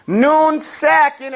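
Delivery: Noon sakin drawn out slowly; slow model word. Shown as a demonstration. Teacher modelling noon sakin slowly for students. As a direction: stretch out Noon sakin for them to copy. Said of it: "Everybody say noon sakin".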